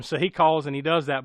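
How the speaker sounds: normal talking voice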